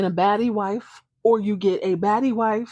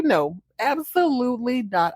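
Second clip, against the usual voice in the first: ordinary talk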